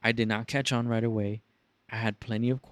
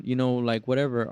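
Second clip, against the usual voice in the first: normal talking voice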